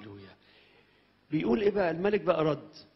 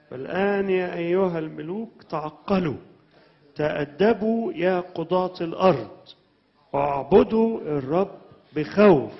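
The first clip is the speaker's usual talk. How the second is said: louder forceful warning to the kings; preaching; 4 semitones higher, 11 dB louder, much slower than usual. Acted. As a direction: thunder the warning to earth's kings. Preaching psalm warning at full force. (وعظ بقوة وصوت عالٍ)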